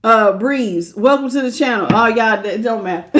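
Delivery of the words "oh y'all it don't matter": normal talking voice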